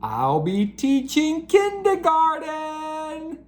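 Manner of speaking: lively, expressive storybook reading; animated children's book narration. A narrator's reading. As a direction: read it like bedtime story time for kids.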